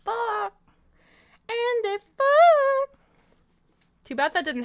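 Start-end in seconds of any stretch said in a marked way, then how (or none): 0.1-0.5 high-pitched punchline. Acted. Streamer summing up the book, high squeaky punchline. 1.5-3.0 very high, loud, long-held squeal; squealed punchline. Acted. Streamer repeating the book's punchline, loud high squeal.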